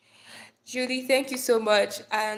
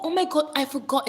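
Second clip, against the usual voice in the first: ordinary talk, nothing marked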